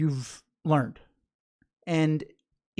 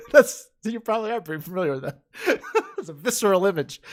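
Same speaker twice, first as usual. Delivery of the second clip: real amused laughter; 3 semitones higher, faster than usual. Real, not acted. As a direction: talk between bursts of laughing. Still laughing at toenail suggestion.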